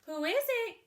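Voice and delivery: put-on high, loud old lady calling out; granny calling out. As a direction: call through the door like a grandma. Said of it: "called out Granny".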